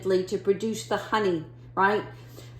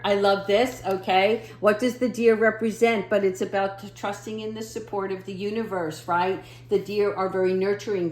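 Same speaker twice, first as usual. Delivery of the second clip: ordinary talk, nothing marked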